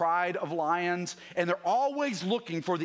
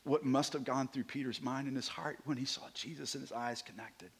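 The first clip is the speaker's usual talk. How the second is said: hushed; 4 semitones lower, 7 dB quieter than usual. Real, not acted. Imagining Peter meeting Jesus' eyes.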